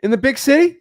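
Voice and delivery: high, loud disbelieving question; shocked disbelief; incredulous. Retold or imitated. Shocked at low Italian salaries.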